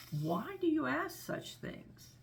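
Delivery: high, slow, drawn-out crocodile question; inquisitive crocodile. Acted. Crocodile questions the elephant's child, high and slow. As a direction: draw the question out slowly, pitched high. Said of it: "said the crocodile".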